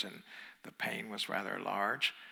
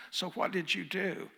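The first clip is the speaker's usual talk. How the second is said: the surgeon asking, pitched higher; surgeon's voice; 7 semitones higher than usual. Retold or imitated. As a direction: play the puzzled surgeon, pitched up. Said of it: "and he goes".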